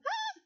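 very high, brief mock scream; thin mock-terrified "ah"; scream of fear. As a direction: let out a small high frightened shriek. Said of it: "grites de miedo".

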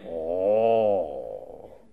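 low, soft, drawn-out ooh; mock-reverent murmur of awe; impressed "oh". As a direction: show hammed-up admiration. Acted admiration of an engineer.

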